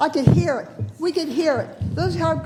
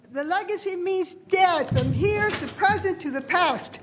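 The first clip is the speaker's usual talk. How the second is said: ordinary delivery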